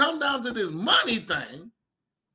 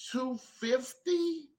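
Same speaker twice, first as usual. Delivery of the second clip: small, timid price ask; nervously floating the price as a question; scared; 4 semitones higher, 8 dB quieter, much slower than usual. Retold or imitated. Leatherworker nervously quoting a customer, soft and scared.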